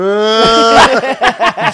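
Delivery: high, loud startled yelp; dog yelp. Retold or imitated. Dog flinching at the hand, a high loud yelp.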